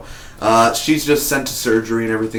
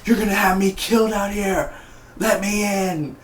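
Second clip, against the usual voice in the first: higher, scared begging at the door; panicked Stavo; 9 semitones higher than usual. Retold or imitated. Stavo begging friends to open the theater, higher and panicky. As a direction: plead to be let in before you get killed. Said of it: "you know he would be like".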